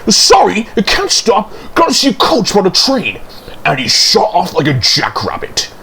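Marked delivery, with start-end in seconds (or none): 0.1-3.2 high, quickening, flustered excuse; hurried. A narrator's reading. Thomas can't stop to talk, high and hurried. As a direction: rush the apology, pitched well up. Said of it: "he went on hurriedly".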